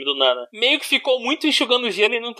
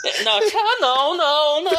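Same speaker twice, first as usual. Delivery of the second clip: laughing protest; 7 semitones higher than usual. Real, not acted. Mock protest while laughing.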